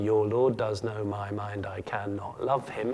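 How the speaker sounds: slow, measured syllable-by-syllable line; counting out syllables. Shown as a demonstration. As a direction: lay the verse out slowly to count it. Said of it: "iambic pentameter has ten syllables".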